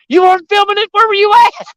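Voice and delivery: very high-pitched, indignant disbelief; squealed retold complaint; incredulous mock protest. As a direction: demand it as a let-down husband. Retold complaint to wife over camera.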